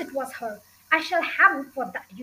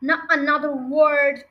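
shouted order; 6 dB louder than usual. A narrator's reading. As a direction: shut the teacher down firmly. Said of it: "shouted Miss Trunchbull".

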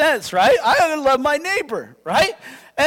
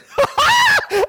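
loud burst of laughter; 3 dB louder than usual. Real, not acted. Laughs at own line.

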